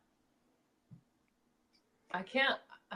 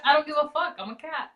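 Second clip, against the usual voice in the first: higher, louder, a snotty cat who doesn't care; sassy cat voice; 8 semitones higher, 4 dB louder than usual. Retold or imitated. Host voicing her indifferent cat to her co-host, high sassy tone. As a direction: voice the cat shrugging you off. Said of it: "And they're like".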